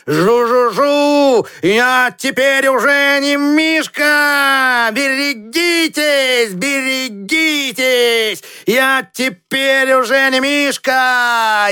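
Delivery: long buzz into high, drawn-out calls; slow, high, stretched-out storybook shouts; boy crying out. A narrator's reading. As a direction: buzz and call like a boy playing aeroplane. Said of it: "и кричал он".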